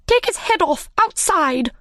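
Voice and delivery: high shouted command; shouted order. A narrator's reading. The Queen orders the Hatter's beheading, shouting. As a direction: fling the order after him. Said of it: "shouted the Queen".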